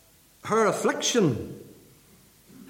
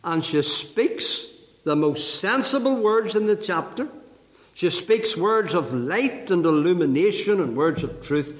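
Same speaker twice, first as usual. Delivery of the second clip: normal talking voice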